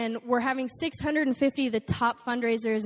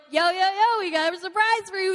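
excited greeting; 7 semitones higher, 4 dB louder than usual. Retold or imitated. Calling to tell the surprise.

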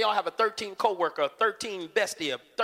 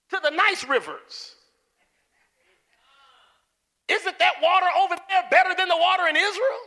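higher, louder mock rant of a proud man; indignant Naaman; 7 semitones higher, 4 dB louder than usual. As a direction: ham up his offended complaining. Preacher acting out angry Naaman.

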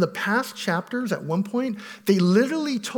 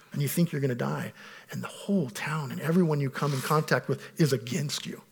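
normal talking voice

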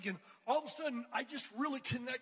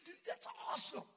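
excited shout; 7 semitones higher, 3 dB quieter, faster than usual. Retold or imitated. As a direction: cheer it out like great news. Thrilled Danny joins retreat.

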